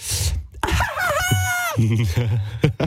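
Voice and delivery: very high, drawn-out shriek; scream. Shown as a demonstration. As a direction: shriek high to test the mic. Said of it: "wie so ein Schrei".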